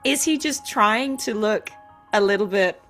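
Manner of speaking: ordinary talk, nothing marked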